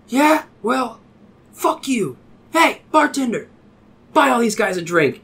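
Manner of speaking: high-pitched, punchy retort; brash bar stranger. Retold or imitated. Stranger hailing the bartender for a round, brash.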